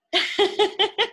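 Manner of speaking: a high bright giggle at the page. Real, not acted. Host laughing at Piggy for the children. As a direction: laugh out high at the silly frog.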